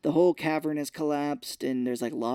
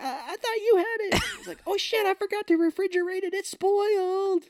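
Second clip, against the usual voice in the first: much higher cartoon squeak; squeaky rock people. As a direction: squeak it like tiny frantic creatures. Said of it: "they were like".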